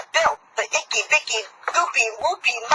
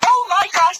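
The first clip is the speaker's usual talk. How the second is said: usual speaking voice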